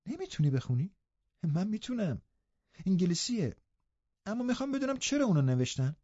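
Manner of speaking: amazed he cannot read; surprised. Acted. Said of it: "کاترین با تعجب گفت".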